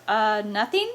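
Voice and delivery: "nothing" rising like a question. Acted. Friend caught hiding the meter, "nothing" said as an unsure question. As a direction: let "nothing" lift uncertainly at the end. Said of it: "sounded more like a question than a statement".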